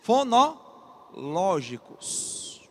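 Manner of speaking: very slow, split into syllable groups; drawn-out key term. Shown as a demonstration. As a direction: stretch "Fonológicos" out for the class. Teacher stretches key term for emphasis.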